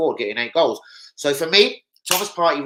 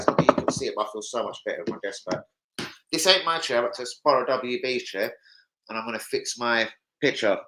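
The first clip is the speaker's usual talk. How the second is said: ordinary talk, nothing marked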